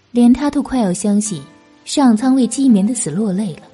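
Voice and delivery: plain reading voice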